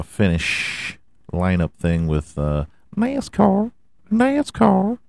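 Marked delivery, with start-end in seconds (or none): 3.0-3.7 "nascar" pitched way up, slow rising; high questioning nascar. Shown as a demonstration. Teasing listeners about the sport, high rising. Says the word twice. 4.1-5.0 "nascar" even higher, rising; squeaky nascar. Shown as a demonstration. Mocking the sport's name, squeaky high. Says the word twice.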